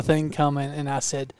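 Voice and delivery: usual speaking voice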